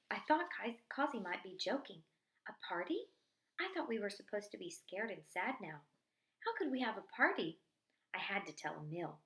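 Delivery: plain reading voice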